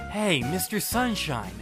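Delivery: ordinary delivery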